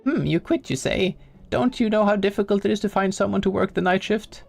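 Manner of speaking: high-pitched, wounded boss voice; whiny plea from a boss who has been quit on; guilt-tripping boss, put out. Acted. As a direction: play a theatrically hurt employer, pitched well up. Acts the game's boss.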